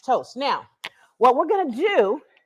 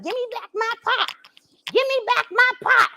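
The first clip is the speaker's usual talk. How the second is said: voiced stove; 10 semitones higher than usual. Cook gives the beeping stove a high whiny demanding voice. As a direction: whine like a stove that wants its pot.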